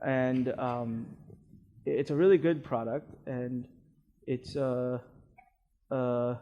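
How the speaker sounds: low, quiet, halting sales pitch; hesitant pitch. Shown as a demonstration. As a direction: mumble the offer unsure of it. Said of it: "do you know what message you're sending".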